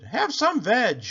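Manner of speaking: high, loud hooted offer; eager duckbill call; hooting. A narrator's reading. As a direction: hoot it out in welcome. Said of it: "And they hooted".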